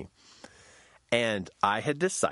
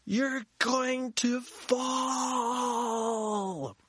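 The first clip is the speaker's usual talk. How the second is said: mock panic scream; much slower than usual. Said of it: "My brain screams at me".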